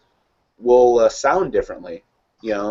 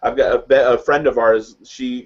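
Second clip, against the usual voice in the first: ordinary talk, nothing marked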